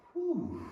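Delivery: sharp high whew of relief; relieved exhale. Real, not acted. Teacher coming down from headstand, relieved whew. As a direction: blow out the effort. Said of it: "I have no idea how long that was".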